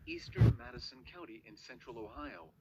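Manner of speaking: flat text-to-speech emergency alert voice; robotic warning read. Acted. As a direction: read it like an automated weather bot.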